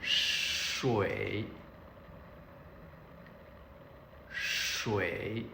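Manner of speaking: 水 stretched out, long sh and dipping tone; slow model pronunciation. Shown as a demonstration. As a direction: draw out 水 so every sound is heard. Teacher models the word slowly.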